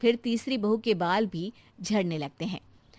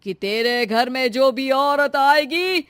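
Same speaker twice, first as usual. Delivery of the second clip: baba's curse; 3 semitones higher, 8 dB louder than usual. Acted. Baba curses Shanti.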